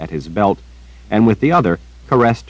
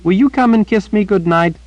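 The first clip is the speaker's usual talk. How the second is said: put-on high, earnest little girl chattering; bright-eyed child repeating gossip to the old wizard; 3 dB louder than usual. A narrator's reading. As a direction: pitch it up like a young girl telling news. Child Rachel voiced.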